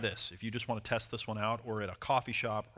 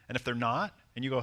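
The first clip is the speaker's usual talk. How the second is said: ordinary delivery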